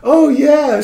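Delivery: very high-pitched female impression; imitated sister. Sister comes out to explain, imitated in a high voice. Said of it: "The sister comes out".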